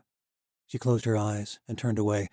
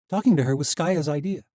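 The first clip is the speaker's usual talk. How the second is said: put-on young woman voice; 7 semitones higher, 7 dB louder than usual. A narrator's reading. Misha voice.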